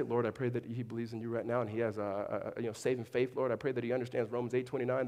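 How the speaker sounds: low, quiet recited prayer; mock pious prayer. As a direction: murmur a make-believe formal prayer, low and soft. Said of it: "I didn't say".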